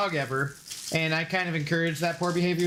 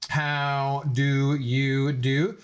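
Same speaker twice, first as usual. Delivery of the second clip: slow formal greeting; 3 semitones lower, much slower than usual. Acted.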